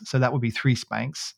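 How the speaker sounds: normal talking voice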